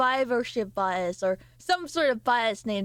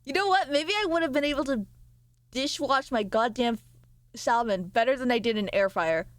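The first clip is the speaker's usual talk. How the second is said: usual speaking voice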